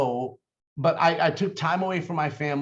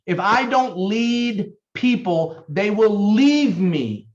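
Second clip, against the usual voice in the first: slow, stressing don't and leave; deliberate closing repeat; slow repeated dictation; 3 semitones higher, slower than usual. Shown as a demonstration. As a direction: dictate once more, firmly. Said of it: "Write it down".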